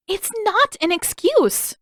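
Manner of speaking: high, loud indignant cry; indignant protest. Acted. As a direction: fire back, offended and shrill. Katara protests to her brother.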